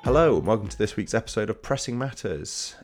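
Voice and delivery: lively host welcome; show opener. Acted. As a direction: open the episode like a host. Host opens episode.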